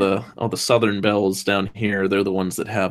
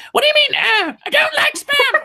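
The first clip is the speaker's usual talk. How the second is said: shrill falsetto Monty Python housewife, much higher and louder; high mock lady squeal asking about spam; fake lady voice; 5 dB louder than usual. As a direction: squawk it like a man faking a lady. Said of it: "the fake, uh, lady voices".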